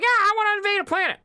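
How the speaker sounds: high, fast cartoon alien villain voice. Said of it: "And he's like".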